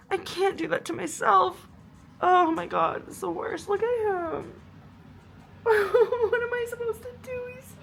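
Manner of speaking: real sobbing laugh; crying. Real, not acted. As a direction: break down while laughing. Crying, looking at dog.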